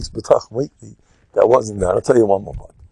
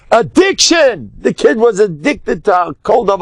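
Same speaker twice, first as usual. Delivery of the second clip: dramatic alarm; 10 semitones higher, 4 dB louder than usual. Shown as a demonstration. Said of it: "dramatizing fear of the word".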